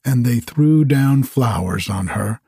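calm storyteller reading slowly; bedtime story narration. A narrator's reading. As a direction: read it like a lullaby.

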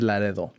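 Laredo said the Spanish way, lah-REH-tho; Spanish-style "Laredo"; Spanish pronunciation. Shown as a demonstration. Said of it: "I've never said".

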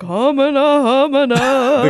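slow, moaning spooky ghost. Acted. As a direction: moan it out like a ghost. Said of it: "in ghost voice where it's like".

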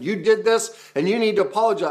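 high, loud demand "you need to apologize"; accusing demand. Shown as a demonstration. Coach acting out the wrong accusing demand, high and loud. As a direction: hurl the blame at them. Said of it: "I'm not claiming".

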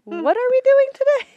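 high, loud goofy voice; silly high voice. Acted. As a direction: squeak it out playfully.

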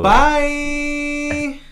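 high loud sign-off; loud sing-song bye. Real, not acted. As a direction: call out goodbye. Hurried sign-off.

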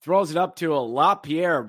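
normal talking voice

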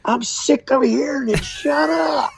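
high shouted complaint, mimicking her; mock shout. Lady complains about her brother's loud sermons, high mock shout. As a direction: fling it out high and loud, fed up. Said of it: "He said".

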